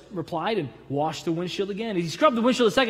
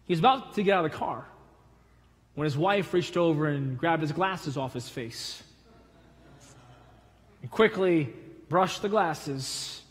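ordinary delivery

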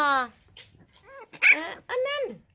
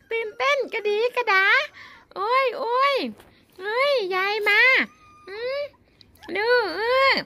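much higher, squeaky pet-talk voice; 10 semitones higher, 3 dB louder than usual. Real, not acted. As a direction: coo at the excited puppies in a high voice. Greeting excited dogs in baby talk. (เสียงเล็กแหลมสูงตื่นเต้น พูดกับหมา)

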